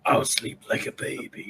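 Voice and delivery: whispered, high rocky rasp; talking rock. Acted. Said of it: "In the most rock noise voice ever".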